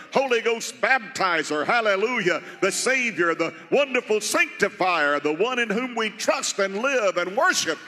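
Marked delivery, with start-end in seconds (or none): 5.6-7.8 fervent preaching. Real, not acted. Pastor warning the church about idols on the throne, urgent preaching. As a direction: lift the warning up over the church.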